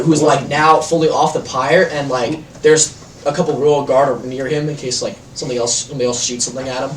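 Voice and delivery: ordinary talk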